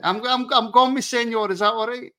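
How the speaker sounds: jokey fake Spanish accent; mock Spanish accent. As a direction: ham up a put-on Spanish voice. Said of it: "I'm doing Spanish".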